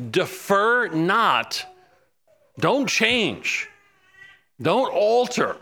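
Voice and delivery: preacher pleading Daniel's cry to God. Retold or imitated. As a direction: implore God not to change. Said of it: "he's crying out to God not to alter".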